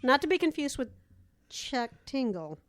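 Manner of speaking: ordinary talk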